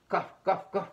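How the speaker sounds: loud "cough, cough" said as words; spoken mock cough. Shown as a demonstration. Teacher miming a cough for the class, spoken mock cough. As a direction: say the cough like a word, playfully.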